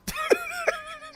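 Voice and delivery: high burst of laughter; laughing. Real, not acted. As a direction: crack up at your own impression. Cracks up at own impression.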